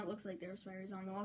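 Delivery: ordinary talk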